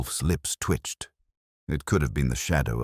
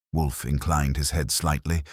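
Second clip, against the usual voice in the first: ordinary delivery, nothing marked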